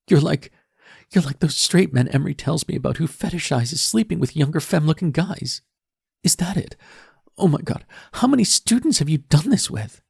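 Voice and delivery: put-on high, loud teen girl, crying with rage; tearful furious daughter. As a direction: tear into him through tears. Daughter accuses father, crying.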